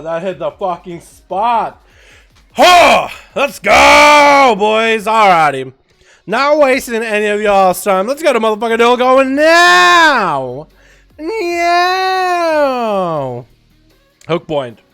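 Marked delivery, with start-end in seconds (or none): 2.5-13.5 frat bro. Real, not acted. Streamer hyping up the boys.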